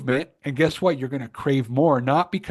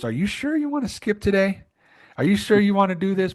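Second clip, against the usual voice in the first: higher-pitched questioning inner voice; iç ses; 6 semitones higher than usual. Acted. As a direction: play a needling inner voice, pitched up. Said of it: "this really awesome voice that goes".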